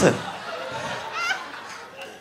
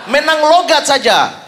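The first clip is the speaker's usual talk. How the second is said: high, stretched-out shout; retold yelling; 7 semitones higher, 3 dB louder, slower than usual. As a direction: imitate a fan yelling a put-down from afar. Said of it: "tiba-tiba dia teriak".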